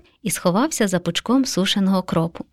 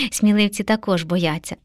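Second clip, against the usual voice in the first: usual speaking voice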